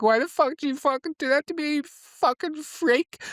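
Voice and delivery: high, angry hammed-up screech, slowing on the last words; mock furious shriek. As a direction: scream at him while hitting him. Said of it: "she starts hitting him like".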